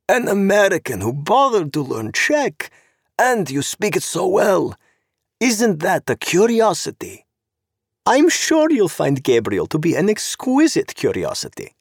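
high, purring voice of a sharp-clawed society woman, mocking; sweetly catty, like an angry cat smiling; sly socialite. Zorena voiced by narrator.

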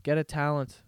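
ordinary delivery

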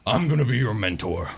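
lightly voiced elderly minotaur mentor; a hint of a grizzled old drunkard; Korak Ironhide. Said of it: "As Korak Ironhide says".

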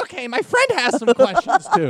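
high, slow, silly caller; high mock caller voice. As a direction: squeak it out like a goofy caller. Suggested prank line.

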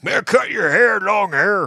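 high, loud mock-farmer holler; old farmer. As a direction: holler at a hippie like a crusty farmer. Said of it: "old farmer guys being like".

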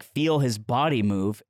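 ordinary talk

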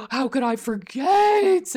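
slow, stunned disbelief; drawn-out shocked realization; surprised. Real, not acted. Suddenly remembers topic.

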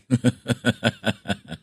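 real chuckle at own joke; short, rapid run of chuckles. Real, not acted. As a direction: laugh lightly at your own quip. Host jokes about visitors.

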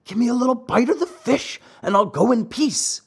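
high, pleading dog voice; whining dog. A narrator's reading. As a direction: beg like a hungry, whining dog. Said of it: "whining and wagging his tail".

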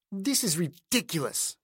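put-on disdainful Dutch-accented sneer; cultured Dutch accent, dripping disgust. A narrator's reading. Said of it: "her cultured Dutch accent dripping with disgust".

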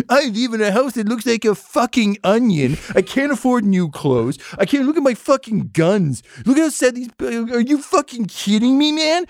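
unhinged rant. Acted. Broke noble ranting at the investigators, high and unhinged. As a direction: lose it completely, voice cracking upward. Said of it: "unhinged Steve Martin at the end of a Steve Martin film rant".

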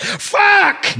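high yelled curse, in a put-on voice; mock kitchen shout. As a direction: blurt it like a panicked cook. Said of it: "you're like".

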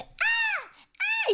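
very high, piercing squeal; excited child squeal. Shown as a demonstration. A mother showing how little kids scream with excitement, piercing squeal. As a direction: squeal like an overexcited toddler. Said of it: "la emoción de".

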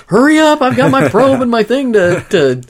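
high, loud panicked rush; frantic hurry. Retold or imitated. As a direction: yelp it like you're out of time. Mimics frantic player racing the game.

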